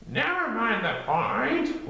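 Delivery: high, slow whistly gopher voice; whistling Gopher. A narrator's reading. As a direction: whistle through the words like a gopher.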